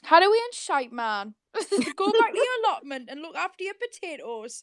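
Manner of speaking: playful Geordie heckle with a laugh; Geordie accent. Pretend. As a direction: lay the Geordie on thick. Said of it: "I sound a bit like Sarah Milligan".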